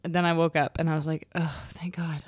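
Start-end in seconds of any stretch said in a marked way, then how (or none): 1.4-2.2 whispered relief. Real, not acted. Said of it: "unvoiced whisper".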